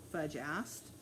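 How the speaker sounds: plain reading voice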